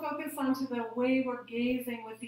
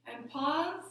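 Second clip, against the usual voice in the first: rising hold at the top of the breath; suspended rising cue; 2 semitones higher than usual. Shown as a demonstration. Yoga teacher holding the class after inhaling, rising lilt. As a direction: lilt upward and leave it hanging.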